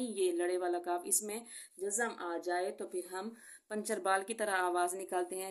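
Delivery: normal talking voice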